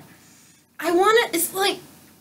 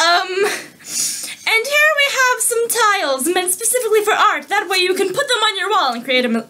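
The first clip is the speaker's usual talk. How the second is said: higher-pitched mock sales presenter; advertising pitch; 5 semitones higher than usual. Friend improvising an ad for tiles, presenter voice. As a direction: present it like a TV product demo. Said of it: "Advertise the item nearest to you".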